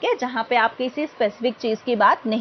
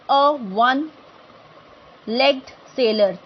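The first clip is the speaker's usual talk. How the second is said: slow careful example; much slower than usual. Shown as a demonstration. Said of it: "teacher models example slowly".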